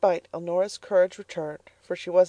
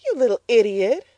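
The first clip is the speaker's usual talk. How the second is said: louder and higher contempt; scornful insult; 6 semitones higher, 7 dB louder than usual. Acted. Mrs Comstock scolding her daughter, louder. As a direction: spit out the insult. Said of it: "said Missus Comstock".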